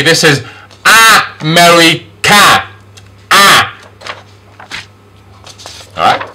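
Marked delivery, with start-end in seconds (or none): 0.8-3.7 slow America, split by syllables; A-sound demonstration. Shown as a demonstration. Teacher models basic letter A, America in slow syllables. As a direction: break up America, isolate the A. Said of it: "which is A or A".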